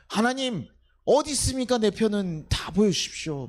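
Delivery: high, loud pleading demand; demanding prayer. Retold or imitated. A believer demands God show a sign, loud and high.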